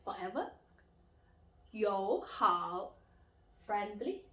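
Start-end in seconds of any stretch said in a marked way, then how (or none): 1.7-2.9 teacher modelling 友好 syllable by syllable; carefully intoned, emphatic 友好; third-tone demo. Shown as a demonstration. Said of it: "symbol of the third tone".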